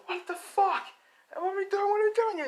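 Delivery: very high-pitched, frantic character voice; panicked squeal. Acted. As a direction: squeak out alarmed questions way up high. Companion panics as hero leaps.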